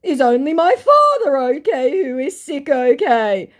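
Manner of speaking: high, crying put-on voice of the scammer; mock scammer voice. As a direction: wail it like a caught con man pleading. Scammer's messages read aloud.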